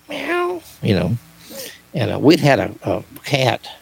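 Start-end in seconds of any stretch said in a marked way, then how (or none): 0.1-0.6 a tiny, high, quiet meow; imitated cat meow. Retelling the spirit box, a small high cat meow. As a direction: squeak out a little cat's meow. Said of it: "we heard this little cat go".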